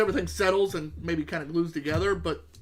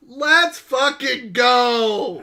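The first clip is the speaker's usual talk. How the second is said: loud, drawn-out hype shout; celebrating with a stretched-out yell; excited cheer; 5 semitones higher, 8 dB louder, much slower than usual. Real, not acted. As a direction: cheer it out slow and big. Cheering a listener's comment.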